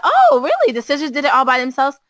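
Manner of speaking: high, loud mock-surprised dad; mock surprise. Dad teasing his daughter with hammed-up disbelief. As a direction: feign disbelief, light English dad. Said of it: "he said".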